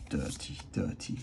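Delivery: whispered under the breath; whispering. Real, not acted. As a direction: hush it like a secret. Repeats the verdict in a whisper.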